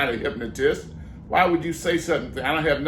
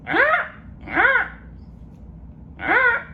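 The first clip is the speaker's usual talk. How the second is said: very high squawking parrot; much slower than usual. Acted. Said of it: "Sonny, uh, the parrot".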